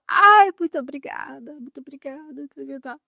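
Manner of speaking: high, gushing relieved thank-yous that fade out; lightly acted gushing gratitude. Thanking the kind bus official, high and lightly gushing. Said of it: "Aí eu".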